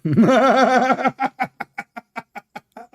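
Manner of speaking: long, high, loud laugh; loud cackle. Real, not acted. Host mocks opponent's prophets, long loud cackle. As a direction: burst out cackling at your own jibe.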